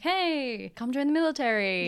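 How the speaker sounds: high, cheery recruiter call; recruiter pitch. Retold or imitated. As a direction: sell it like a recruiter. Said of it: "they're not like".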